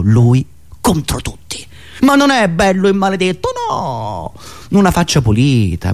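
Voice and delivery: ordinary delivery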